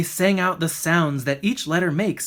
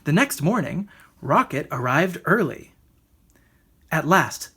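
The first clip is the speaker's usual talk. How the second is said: usual speaking voice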